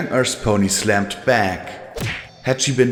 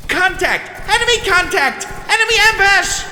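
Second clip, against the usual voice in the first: hysterical screaming; faster than usual. A narrator's reading. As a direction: scream the alarm in hysterical panic. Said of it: "the mare screamed hysterically".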